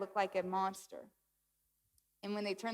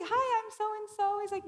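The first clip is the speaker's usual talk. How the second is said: breezy doctor; 11 semitones higher than usual. Retold or imitated. Doctor introducing himself to a nervous patient, high and breezy. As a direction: introduce yourself in a lifted, sing-out voice. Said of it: "He comes in, he's like".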